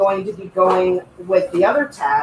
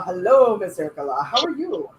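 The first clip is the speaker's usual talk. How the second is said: ordinary delivery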